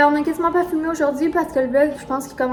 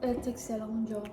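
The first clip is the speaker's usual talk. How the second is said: quiet; 14 dB quieter than usual. Real, not acted. Eating ice cream, soft voice.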